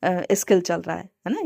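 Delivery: usual speaking voice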